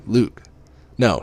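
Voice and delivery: ordinary delivery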